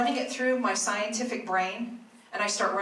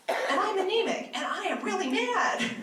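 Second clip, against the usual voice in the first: exasperated disbelief; 9 semitones higher than usual. Retold or imitated. Retelling the shocking test result.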